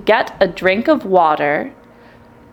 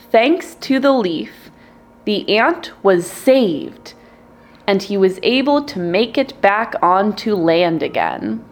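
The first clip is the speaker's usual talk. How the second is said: ordinary delivery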